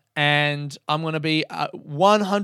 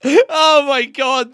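slow, loud, higher oh my God; giddy disbelief before an ad; giddy exclaim; 10 semitones higher, 8 dB louder, much slower than usual. Real, not acted. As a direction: relish the absurdity. Giddy about sponsor read.

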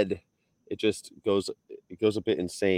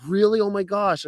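high-pitched mock surprise; retold amazement; 12 semitones higher than usual. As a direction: imitate her delighted disbelief, pitched up. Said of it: "She's like".

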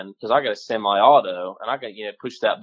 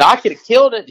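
much higher and louder, worked up; excited buddy; 12 semitones higher, 9 dB louder, faster than usual. Retold or imitated. The buddy tells the hunter he could have shot, higher and louder. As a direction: blurt it out like the buddy did. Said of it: "He said".

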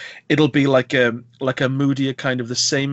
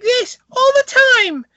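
very high, loud, whiny screech of a voice; mock the grating girl at full pitch; piercing whine, hammed up for laughs; shrill real girl impression; 8 dB louder than usual. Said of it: "I'll do an impression of the voices".

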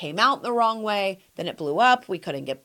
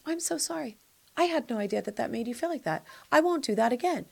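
soft, higher-pitched imitated apology; apologetic quote; 3 semitones higher, 4 dB quieter, faster than usual. Host voices her loved ones apologizing, soft and contrite. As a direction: voice a sincere, gentle apology. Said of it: "they go like this".

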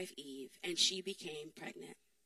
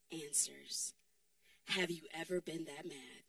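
ordinary delivery

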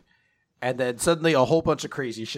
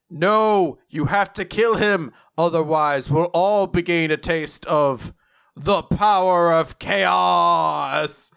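loud, higher, building to a drawn-out finish; dramatic movie line; 4 semitones higher, 5 dB louder, slower than usual. Retold or imitated. Lake warns they'll taste chaos, dramatic movie line. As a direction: declaim it, stretching the last words slowly. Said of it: "like, a movie line".

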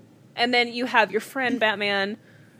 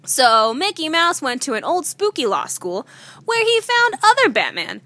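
higher, mock-dramatic read-aloud; story reading; 5 semitones higher, 4 dB louder than usual. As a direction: read the silly tale like a spooky bedtime story. Reads silly story aloud.

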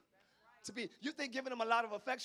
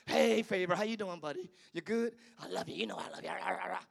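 tender to the dog; 5 semitones lower than usual. Retold or imitated. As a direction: coo at the dog like a buddy. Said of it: "Then I'll be like".

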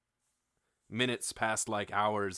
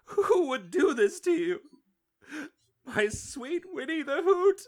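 high, loud hammed-up wail; grief-stricken crying, shrilly pitched and loud; uncontrollable sobbing; 7 dB louder than usual. A narrator's reading. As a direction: sob it out in a very high voice. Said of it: "He begins to sob uncontrollably".

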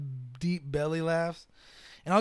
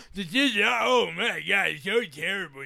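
mocking disgust. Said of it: "You're just the whole time like".

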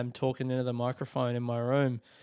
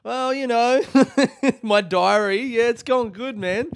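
awkward sheepish reply; 8 semitones higher, 5 dB louder than usual. Retold or imitated. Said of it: "And I was like".

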